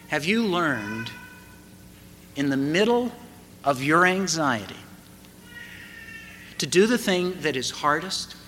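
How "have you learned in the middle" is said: ordinary delivery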